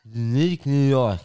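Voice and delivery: slow, tongue-held lisp like Tyson; tongue-held twister, sounding like Tyson; holding tongue. Shown as a demonstration. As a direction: force it out with your tongue held. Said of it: "You sound like Tyson".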